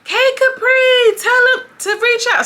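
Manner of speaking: high, loud, slow scream; screaming back. Retold or imitated. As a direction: yell it back across the room. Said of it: "Amber screams back".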